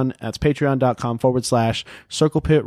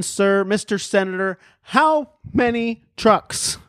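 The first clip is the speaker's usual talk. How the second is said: higher-pitched mock interrogation; mock senator grilling; 8 semitones higher, slower than usual. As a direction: press him like a pretend hearing questioner.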